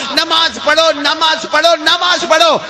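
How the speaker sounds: high, urgent repeated command; a light take on a saint urging his disciples; saint's command. Retold or imitated. Preacher voicing the saint commanding disciples to pray. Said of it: "اپنے سارے مریدوں کو کہا".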